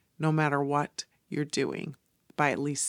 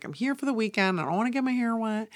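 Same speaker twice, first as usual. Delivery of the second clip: higher, quicker worried self-talk; anxious inner voice; 6 semitones higher, faster than usual. Retold or imitated. Telling listeners her old worry, higher and quicker. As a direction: fret about wet hair, half joking.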